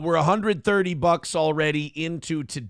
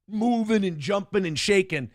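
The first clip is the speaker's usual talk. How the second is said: ordinary talk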